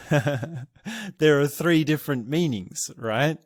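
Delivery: normal talking voice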